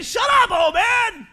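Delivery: high, hammed-up crowd heckle; mock angry yell. Crowd silencing the blind beggar, mock angry yell. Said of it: "People were saying".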